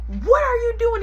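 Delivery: high, loud mock scold; mom scolding. Mom scolding son at night, high. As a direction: demand an answer like mom. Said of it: "She like".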